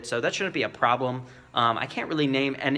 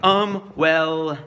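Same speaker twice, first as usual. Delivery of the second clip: hesitant stall; 4 dB louder than usual. Shown as a demonstration. Salesman caught off guard, hesitating. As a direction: stall as if the question threw you. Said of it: "I'm not like".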